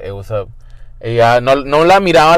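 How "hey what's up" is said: lower, softer, hiding behind a manly voice; a hint of a cool straight dude greeting; lightly faked macho hello; put-on straight guy voice. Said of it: "siempre tenía que cambiar mi voz".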